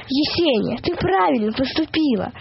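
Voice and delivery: plain reading voice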